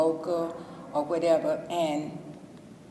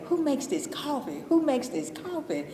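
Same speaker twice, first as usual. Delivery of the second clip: higher voice for the curious bus drivers; bus drivers asking; 8 semitones higher than usual. Retold or imitated. Bus drivers asking who made the coffee, higher curious voice. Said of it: "I had a couple of bus drivers say".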